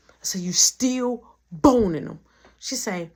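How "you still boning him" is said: slow, loud, drawn-out accusation; defiant repeat. Retold or imitated. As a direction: repeat it slowly and loudly, daring her. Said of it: "Do it again".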